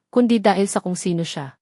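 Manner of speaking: ordinary delivery, nothing marked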